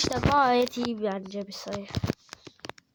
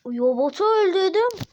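pitched up, louder in triumph; triumphant cry; 6 semitones higher, 4 dB louder than usual. Real, not acted. Oyuncu robotu öldürünce sevinçle bağırıyor. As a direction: crow over the kill.

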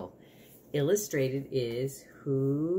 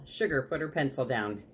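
usual speaking voice

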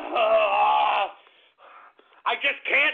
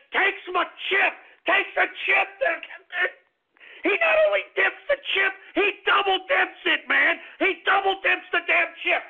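higher, strained outrage; outraged rant; 8 semitones higher than usual. Acted. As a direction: sputter with wounded pride. Outrage over double dipping.

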